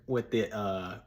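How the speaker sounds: normal talking voice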